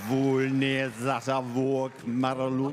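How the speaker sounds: Vulne Zatavok Maraluk spoken slow and loud; Void Tongue. Shown as a demonstration. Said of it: "the Void Tongue specialist".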